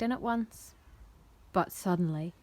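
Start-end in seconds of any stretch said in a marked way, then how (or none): none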